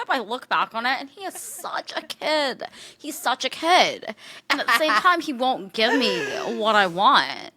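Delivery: high, slow impression, amused underneath; hammed-up reality-star confession; Kristen Cavallari. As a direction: drag the words out in a put-on high voice. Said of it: "Kristen Cavallari".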